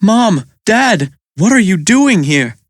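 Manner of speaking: mildly astonished cry; Mike crying out. A narrator's reading. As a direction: call out to unexpectedly found parents. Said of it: "Mike cries".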